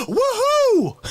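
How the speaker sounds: high, loud joyful whoop; whooping woo-hoo. Retold or imitated. A crowd member whoops loudly across the restaurant. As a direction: let out a big party whoop.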